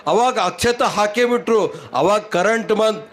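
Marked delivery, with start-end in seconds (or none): none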